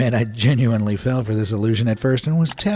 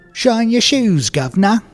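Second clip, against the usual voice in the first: hammed-up Cockney British accent, higher and louder; 10 semitones higher, 6 dB louder than usual. Narrator opens the London bit in mock British accent. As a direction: put on a loud chirpy London street accent. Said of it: "We'll start our British".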